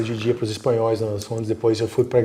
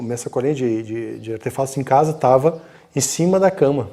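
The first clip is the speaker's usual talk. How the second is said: ordinary talk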